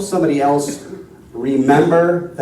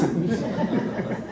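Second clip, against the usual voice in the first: short laugh; 8 semitones higher, 10 dB quieter than usual. Real, not acted. Laugh after punchline.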